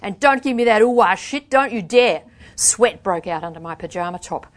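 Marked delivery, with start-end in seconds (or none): 0.0-2.2 loud, raised indignant snap; angry warning. A narrator's reading. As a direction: warn them off, livid. Furious answer to mockery.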